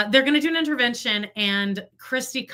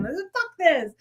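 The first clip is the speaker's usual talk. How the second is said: retold scream; 7 semitones higher than usual. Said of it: "she's just, like, screaming".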